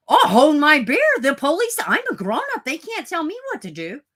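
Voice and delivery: high, loud cocky know-it-all; mocking defiant searcher. Mocking a searcher who ignores the police, high and loud. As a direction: sneer it like a smug busybody ignoring police.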